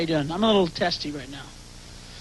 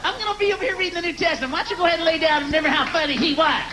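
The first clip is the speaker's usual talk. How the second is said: high, nagging mock wife voice; sarcastic wife; 10 semitones higher than usual. Wife in bedroom needling husband about the comic, high nagging sarcasm. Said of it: "she's gonna go".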